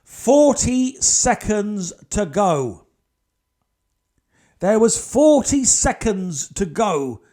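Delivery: very slow, weighty countdown; dramatic slow. Shown as a demonstration. Telling the audience the time left, very slowly. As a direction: stretch every word out slowly. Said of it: "dramatic slowed countdown".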